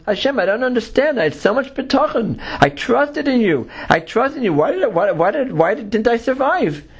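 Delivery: high-pitched pleading complaint, acted; bewildered protest. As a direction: plead your case as the wronged believer in a story. Drowned man protests to God.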